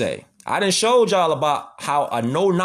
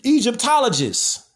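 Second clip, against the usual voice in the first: mock pompous expert; 7 semitones higher than usual. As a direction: announce "Egyptologist." like a smug expert, pitched up. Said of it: "Where they be like".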